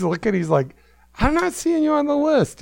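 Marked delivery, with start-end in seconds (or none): 1.2-2.6 much higher Aussie doorman; imitating an Australian accent. Doorman refusing Eric at the door, mock Australian accent. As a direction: doorman turning him away, Aussie-Cockney twang. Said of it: "combination of Australian and Cockney".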